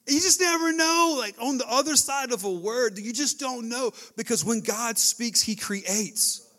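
loud, high preaching climax; impassioned pulpit build; fervent preaching. Real, not acted. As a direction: drive the point home to the crowd. Preaching at full force.

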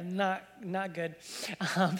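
ordinary delivery

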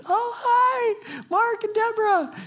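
high, bright mock-surprised hello; squeaky high surprised greeting from the officer. Officer recognizes them, greeting.

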